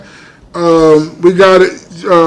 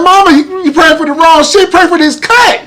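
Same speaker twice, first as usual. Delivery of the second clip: pitched way up, exasperated plea; high indignant protest; 10 semitones higher than usual. Retold or imitated. Bleeding son to praying mother, high exasperated protest. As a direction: whine it at Mama in disbelief. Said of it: "I was like".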